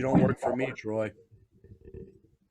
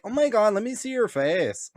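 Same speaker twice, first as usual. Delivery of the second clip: higher swooning voice; imitated swooning girls; 8 semitones higher than usual. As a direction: gush like a smitten girl. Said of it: "they're like".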